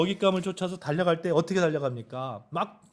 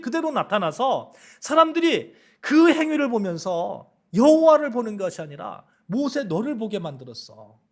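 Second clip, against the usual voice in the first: God's rebuke; 7 semitones higher, 6 dB louder than usual. Acted. God confronting Moses about his old anger, loud reproach. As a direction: scold Moses as God, hammering each charge.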